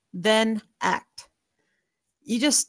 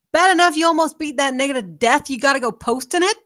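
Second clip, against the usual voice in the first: higher, louder angry scold; 5 semitones higher, 5 dB louder, faster than usual. Acted. Mother scolding daughter for posting the beating, loud and angry. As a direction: chew her out for posting the beating.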